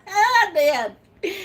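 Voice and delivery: high, laughing admission. Real, not acted. Admitting a slip to viewers, high and laughing. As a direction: giggle through the confession.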